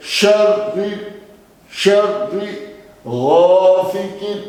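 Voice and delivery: शर्रि with a strong doubled r; stressed shin. Shown as a demonstration. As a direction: press the श of शर्रि, then chant गासिकिन. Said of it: "शीन को जोर से लगाएंगे". (शर्रि पर ज़ोर)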